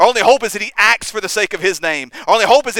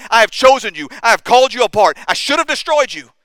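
louder, higher fervent preaching; preaching at full force; 3 semitones higher, 3 dB louder than usual. Acted. Preacher voicing God calling his people saints, impassioned. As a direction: proclaim it with mounting force. Preaching God's sovereign call.

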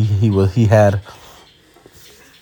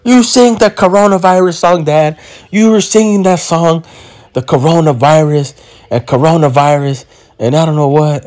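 higher-pitched mock child voice; imitating young daughter; 6 semitones higher than usual. Daughter telling Dad about the coronavirus song, higher imitated child voice. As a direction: play an excited kid, pitched up. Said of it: "She's like".

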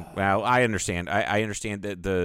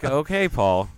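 clerk's line, laughing, a bit higher; imitating the clerk; 5 semitones higher, 4 dB louder, much slower than usual. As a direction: mimic her weary patience through a laugh. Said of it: "she's probably like".

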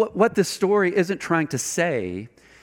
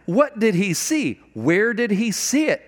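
normal talking voice

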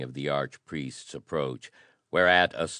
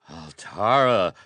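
horrified exclamation; 4 semitones higher, 8 dB louder than usual. Acted. Nobles echo the name in horror.

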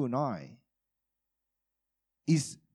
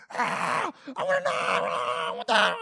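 put-on high whining child voice begging for it; whiny little girl; 7 semitones higher than usual. Father imitating his daughter begging for a knife, high and whiny. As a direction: whine like a four-year-old who wants the knife.

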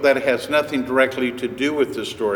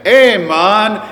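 a bright, loud West African greeting, pitched higher; Sierra Leone accent; 7 semitones higher, 8 dB louder than usual. Retold or imitated. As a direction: call out Hey man in a Sierra Leone accent, loud and high. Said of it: "in his accent".

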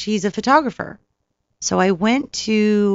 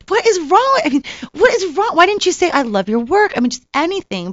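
self-scolding; 9 semitones higher than usual. Retold or imitated. Berating herself for freezing up.

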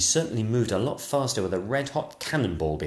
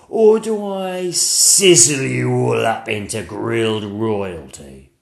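slow, louder dragon threat; menacing dragon; 3 semitones higher, 8 dB louder, slower than usual. Acted. Dragon threatening to grill the royals, slow and menacing. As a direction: savour the threat.

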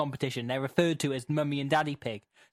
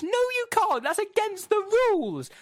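very high, louder cartoon protest; shrieked objection; 4 dB louder than usual. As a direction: squeal it like an outraged character. Acted objection to Peppa's request.

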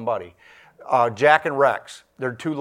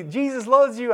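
much higher sing-song inmate voice; imitating Rex; 11 semitones higher than usual. Preacher mimicking Rex ignoring his threat, much higher sing-song voice. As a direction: beam it out cheerfully, unfazed by threats.

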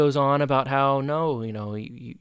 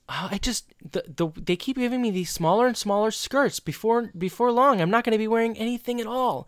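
high-pitched imitation of a complaining skater; acted girlish complaint; 8 semitones higher than usual. Skater complaining about smaller skirts, acted complaint. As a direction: whine about it in a raised, put-on voice.

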